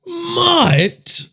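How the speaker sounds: high squeaky outraged mutt; indignant squeak. Real, not acted. Co-host echoes the insult in a high squeak. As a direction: squeak it back in mock offense.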